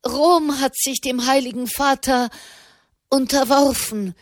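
excited rush of news; bubbling out. Acted. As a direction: let it spill out breathlessly. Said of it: "sprudelte Malherb heraus".

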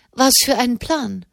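nosy and delighted to ask; curious. A narrator's reading. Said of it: "fragte Madame und hob neugierig".

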